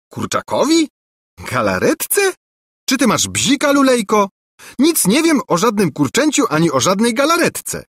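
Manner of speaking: high, mocking disbelief; incredulous princess. A narrator's reading. Angelika scoffs at the prince's story, high incredulous mockery. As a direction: scoff at his silly claim.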